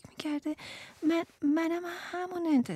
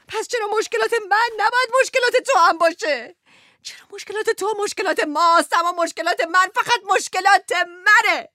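higher and louder, rising outrage; indignant protest; 7 semitones higher, 7 dB louder than usual. Acted. Wife protesting unequal burdens to husband, loud and high. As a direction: throw the unfairness back at him.

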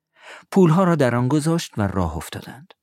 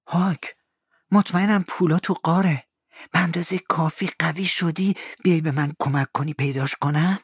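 put-on higher eager boy; Tom's boyish voice; 8 semitones higher than usual. Tom asks Huck to help find the money, eager. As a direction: sound young and keen.

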